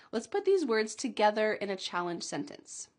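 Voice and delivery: ordinary talk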